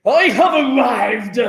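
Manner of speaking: grand, drawn-out mock-regal announcement; proper queen. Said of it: "arrived like a proper queen".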